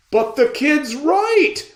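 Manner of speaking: high, loud, slow shout; a townsman shouting support; shout from crowd. Acted. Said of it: "another voice shouted".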